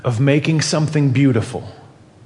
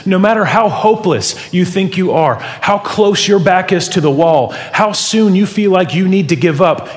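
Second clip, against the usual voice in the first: rising, louder impassioned preaching; preaching at full force; 4 semitones higher, 4 dB louder than usual. Acted. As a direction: build it like a sermon climax.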